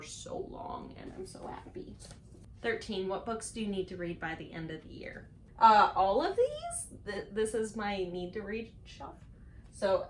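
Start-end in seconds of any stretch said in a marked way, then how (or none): 0.0-1.9 whispered gush. Real, not acted. Gushing over the cover. 5.6-7.0 high, loud and slow; exaggerated answer to the question; excited exclamation. Real, not acted. Pointing at the whole shelf.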